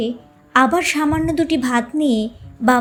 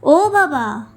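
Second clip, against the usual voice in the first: calling out; much slower than usual. Acted. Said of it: "বাবা চেঁচিয়ে ডেকে বললেন".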